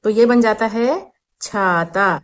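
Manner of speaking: छाता said slowly, the long aa opened wide; slow teaching demo. Shown as a demonstration. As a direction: spell out छाता for a child, slowly. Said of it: "छत पे आ की मात्रा अगर हम लगाते हैं". (छाता को धीरे, खुला आ खींचकर बोलें)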